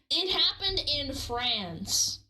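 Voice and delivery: usual speaking voice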